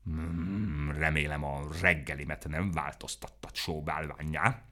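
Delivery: low, soft grumble; a grumpy beast worried about breakfast; grumbling. A narrator's reading. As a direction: mutter it resentfully under the breath.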